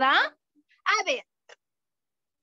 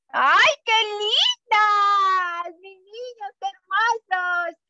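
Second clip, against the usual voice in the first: loud, slow, gushing praise for the little ones; drawn-out loud delight; 5 dB louder, slower than usual. Acted. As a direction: coo over the children like a proud teacher. Teacher praising toddlers' happy faces.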